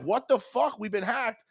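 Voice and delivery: high alarmed retelling; high mock alarm; mock panic. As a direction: imitate his own alarm. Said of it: "And I'm like".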